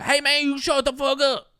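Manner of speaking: put-on high, loud, hollered Southern accent. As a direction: holler it in your best attempt at a Southern accent. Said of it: "That's the best Southern accent I can give you".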